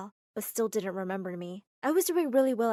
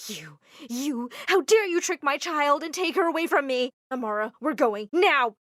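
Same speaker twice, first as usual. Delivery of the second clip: higher, loud outrage building to a shouted now; furious mother; 4 semitones higher, 7 dB louder than usual. Acted. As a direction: confront him, then snap the order. Mama confronts Armitage furiously.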